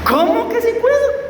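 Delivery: high, rising Costa Rican challenge; tico incrédulo. Acted. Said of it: "Si hubiera sido un tico". (tico reclamando en tono agudo)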